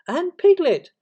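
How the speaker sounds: very high, loud cry of delight; excited. A narrator's reading. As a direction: shout it with boyish excitement. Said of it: "said Christopher Robin excitedly".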